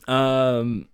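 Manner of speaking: ordinary delivery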